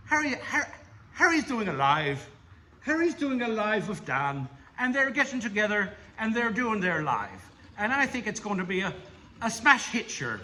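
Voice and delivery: put-on Irish accent. Ribbing his mate Harry about accents, in a mock Irish accent. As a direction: put on a playful Irish lilt. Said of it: "I can do the Irish one".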